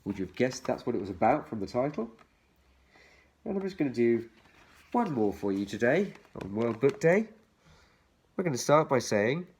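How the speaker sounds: usual speaking voice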